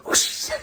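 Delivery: breathy whooshing noise; imitated whoosh. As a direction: whoosh like something flying past. Imitates Whitney flying through air.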